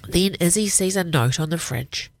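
ordinary delivery

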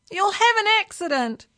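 high, loud warning; yelling. A narrator's reading. Alarmed passengers warn the speeding driver, yelling. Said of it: "yelled the passengers".

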